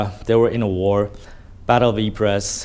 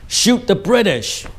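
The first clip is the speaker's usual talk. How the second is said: barked order; 8 semitones higher, slower than usual. Retold or imitated. High command gives order.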